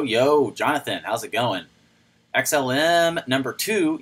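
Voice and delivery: normal talking voice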